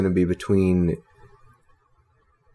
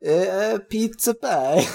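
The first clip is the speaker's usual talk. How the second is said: high-pitched, hammed-up French accent; badly faked French accent, pitched way up; mock French accent; 9 semitones higher, much slower than usual. Joking after co-host's praise, silly high French accent. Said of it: "good French accent you keep going with".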